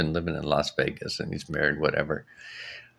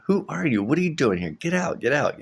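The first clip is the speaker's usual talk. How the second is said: alarmed; 7 semitones higher than usual. Retold or imitated. Ordering intruder out.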